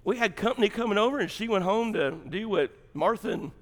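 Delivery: usual speaking voice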